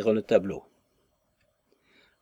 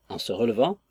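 ordinary delivery

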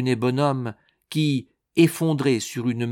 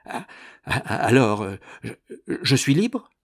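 panting. A narrator's reading. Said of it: "madame Malicet haletait".

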